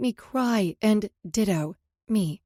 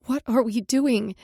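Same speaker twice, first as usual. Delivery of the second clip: sudden, louder, slow outburst; woman blurting out her worry to her lover, louder; blurted; 3 semitones higher, 5 dB louder, slower than usual. A narrator's reading. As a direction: let it burst out. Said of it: "I blurted".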